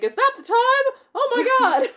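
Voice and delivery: high, loud, mock surprise; overdone shock in a high voice; mock-shocked blonde woman. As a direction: gasp it like a ditzy woman who just noticed the hour. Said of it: "blonde woman inexplicably is like".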